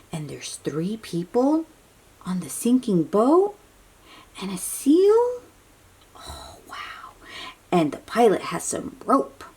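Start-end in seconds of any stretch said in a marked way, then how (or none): none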